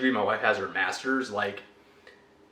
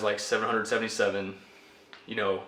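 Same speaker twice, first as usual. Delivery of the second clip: ordinary talk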